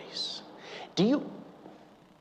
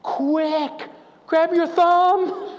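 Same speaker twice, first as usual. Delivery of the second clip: high, loud frantic yell; mock teenage daughter yelling at dad; 5 dB louder, much slower than usual. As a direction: shout it like a panicked teen girl. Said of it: "and yells".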